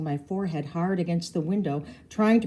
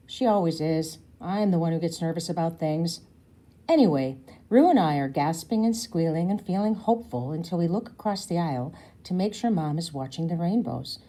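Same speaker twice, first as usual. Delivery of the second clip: plain reading voice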